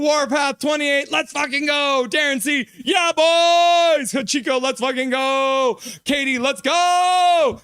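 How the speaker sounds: high, stretched-out victory shouts; jubilant shouting. Real, not acted. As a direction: holler to the fans by name, celebrating. Shouts to fans in chat.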